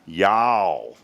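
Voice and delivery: drawn-out Southern y'all; twangy Southern y'all; mock Southern country drawl; country twang. Shown as a demonstration. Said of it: "you gotta get that twang down in there".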